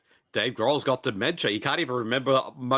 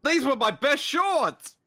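mock lament; 12 semitones higher, slower than usual. Mourning ruined shorts.

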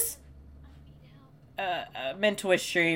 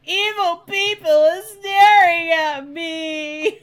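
very high, loud, drawn-out mimicry; mock film character; 12 semitones higher, 10 dB louder, slower than usual. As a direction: wail it slowly like a melodramatic heroine.

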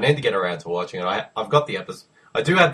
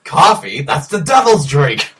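high, loud, slow disgusted quote; acted film reply; 5 semitones higher, 7 dB louder, slower than usual. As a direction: draw it out in loud mock horror.

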